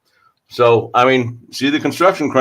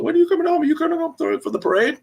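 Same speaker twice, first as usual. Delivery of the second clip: high-pitched mimicry of the major; mock high-voiced questioning; imitating a nagging question; faster than usual. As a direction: put on a high voice for the quoted text. Voices major's text asking when he returns.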